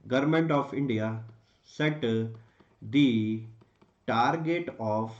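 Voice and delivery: very slow, word-by-word dictation; dictating. Shown as a demonstration. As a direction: spell out the answer phrase by phrase. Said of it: "आप पूरा लिखेंगे".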